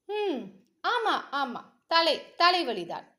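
plain reading voice

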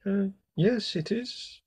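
high, soft, hesitant princess; small voice. A narrator's reading. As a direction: shrink into a timid little admission. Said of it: "in a small voice".

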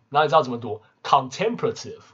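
ordinary talk

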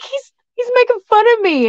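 high-pitched upset protest; upset friend crying. Retold or imitated. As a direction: wail it lightly, imitating a crying friend. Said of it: "like, crying".

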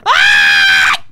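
long, very loud startled scream; scream of fright. Real, not acted. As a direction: scream out in sudden shock. Jump scare in game.

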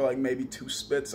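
ordinary delivery

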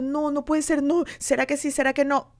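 high-pitched, anxious retelling of her own panic; retold panic. Young girl fearing she is pregnant, anxious and high. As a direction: replay the fear of finding out, voice up high.

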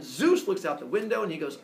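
usual speaking voice

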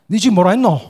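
loud Gyeongsang dialect retort. Retold or imitated. Country-born pastor mocking his Seoul friend, loud Gyeongsang dialect. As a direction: snap back in thick Gyeongsang dialect. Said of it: "경상도 친구인 내가 그러죠".

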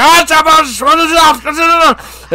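high, panicked yelp; freaking out. Retold or imitated. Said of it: "he's fucking freaking out".

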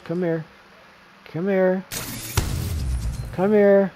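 loud, rising taunt at the enemy; taunting shout. Real, not acted. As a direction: yell it like you're hunting them down. Taunting enemy while fighting.

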